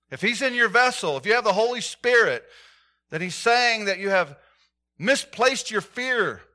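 fervent sermon delivery; full-force preaching. Real, not acted. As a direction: proclaim it to the church.

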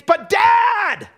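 put-on very high, loud child's protest to Dad; hammed-up shouting at the unfairness; contemptuous complaint. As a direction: yell it like an acted tantrum, stretching the last lines. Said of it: "It's like this contempt".

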